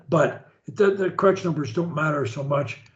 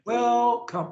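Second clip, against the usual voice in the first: start up high; 5 semitones higher than usual. Shown as a demonstration. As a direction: WELCOME with a high, stretched first syllable. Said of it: "You start up high".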